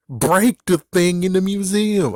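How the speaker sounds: high, loud incredulous exclamation; loud, high-pitched protest; indignant outburst. Real, not acted. Indignant rant about monster's method.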